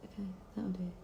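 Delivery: whispered under her breath; whispering. Real, not acted. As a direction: breathe it out to yourself. Muttering to herself over makeup.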